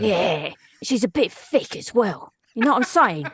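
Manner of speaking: dark wizard. Shown as a demonstration. Showing the host the dark wizard, rough London voice. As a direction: play a thick down-market London villainess. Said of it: "What's that dark wizard voice?".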